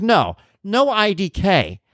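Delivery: high, drawn-out mock exasperation; retold exasperation. Dad rejecting daughter's IDK text, high exasperated retelling. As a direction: stretch out the protest, pitched high.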